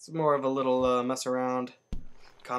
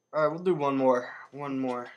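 usual speaking voice

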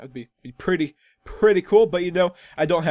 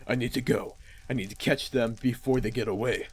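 lower, softer heroic resolve; determined son; 6 semitones lower, 5 dB quieter than usual. Acted. Son tells father he must catch them, low determined voice. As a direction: speak low and hushed, set on the chase.

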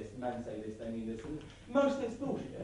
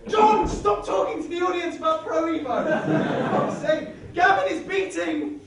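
loud, high shout with laughter; exasperated yell; 7 semitones higher, 9 dB louder than usual. Acted. Linda yelling at John to stop chatting to the audience. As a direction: yell in frustration.

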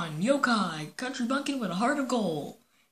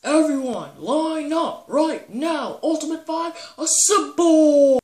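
slow, loud, higher team call; rally the team like a captain; 7 semitones higher, 11 dB louder, slower than usual. Acted. Link calls his team to assemble, loud.

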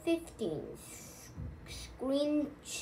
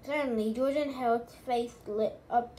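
ordinary delivery

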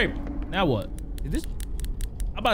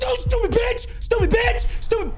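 high furious insults; angry yelling. Real, not acted. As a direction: scream it in rage. Yelling at game enemy.

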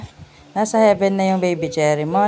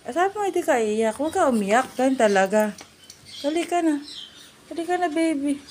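higher, pleading comfort; high coaxing; 8 semitones higher than usual. Real, not acted. Mother coaxes crying child to come see the dead chick, high and sweet. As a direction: urge her along sweetly.

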